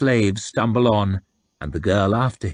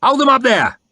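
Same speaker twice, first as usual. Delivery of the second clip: loud, high shouted command; shouted order; 7 dB louder than usual. A narrator's reading. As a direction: yell it down the column. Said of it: "he shouted".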